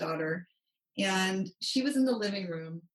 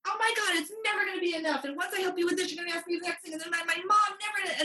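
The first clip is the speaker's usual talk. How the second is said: retold teen yell; 10 semitones higher, faster than usual. Stepdaughter blowing up over being asked to help, high mock yell. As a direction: mimic the stepdaughter's shrill outburst, mildly. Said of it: "she just yelled at me".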